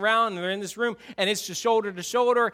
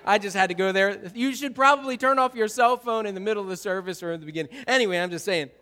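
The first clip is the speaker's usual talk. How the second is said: ordinary talk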